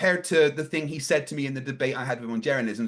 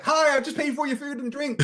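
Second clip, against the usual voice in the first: much higher, lightly put-on voice; lightly imitated Eric; 13 semitones higher than usual. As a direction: quote him in a high-pitched voice. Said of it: "Eric's like".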